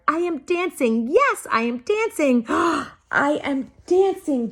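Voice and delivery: high, rising joy with a gasp of wonder; joyful cry. A narrator's reading. Gerald the giraffe, finally dancing under the moon, thrilled gasping cry. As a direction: cry it out in delighted disbelief. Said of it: "Gerald cried".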